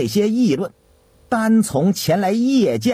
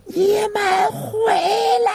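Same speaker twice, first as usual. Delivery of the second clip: dry shout. A narrator's reading. Qiu Deyong calls after the vanished sedan in an empty alley, dry shout. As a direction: stretch it into a long, high-pitched holler. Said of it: "干涩的喊声".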